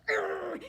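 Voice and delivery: loud hammed-up growl; a princess growling at the king; angry growl. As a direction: growl like a cartoon grump. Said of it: "king with a terrible temper".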